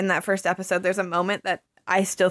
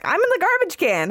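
cat voice; 10 semitones higher than usual. Retold or imitated. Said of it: "they're just like".

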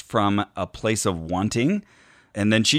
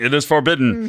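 Beast impression, forbidding; 5 semitones higher, 4 dB louder than usual. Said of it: "My first thought was Beast saying".

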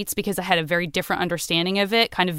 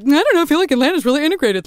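higher, rushed, airheaded self-parody; mock naive opinion; 6 semitones higher, 4 dB louder, much faster than usual. Outsider casually judging Atlanta, mock naive opinion. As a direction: parody yourself being blithely clueless.

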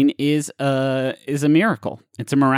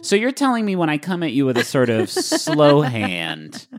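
ordinary talk, nothing marked

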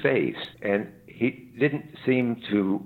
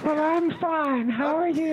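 British governess voice. Pretend. Guest answers the host in a disguised governess phone voice. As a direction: put on a fussy nanny's telephone manner. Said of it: "You do not need to disguise your voice".

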